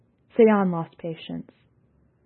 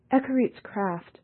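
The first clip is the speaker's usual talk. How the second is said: usual speaking voice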